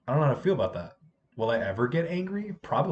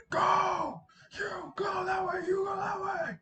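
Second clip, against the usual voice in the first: high, strained, drawn-out shouted order; mock yell; slower than usual. Teacher separating two fighting girls, high mock yell, no anger. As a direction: imitate your loudest command without full volume. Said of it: "I haven't yelled like that".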